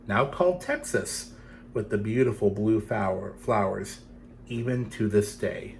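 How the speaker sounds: gentle storytelling read; story narration. A narrator's reading. Reading a legend to children, gentle storytelling.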